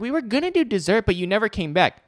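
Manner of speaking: high-pitched mock complaint; imitated complaining customer. Waiter quoting a table's dessert complaint, high mock-customer voice. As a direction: mimic a diner's grievance, pitched well up. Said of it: "So you can yell at me about the check".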